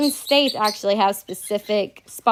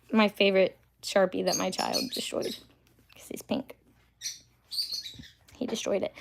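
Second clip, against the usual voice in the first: whispering; 8 dB quieter than usual. Real, not acted. Aside while chewing, hushed.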